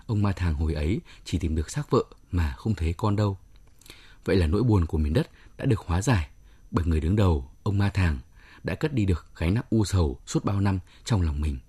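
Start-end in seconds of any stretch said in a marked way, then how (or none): none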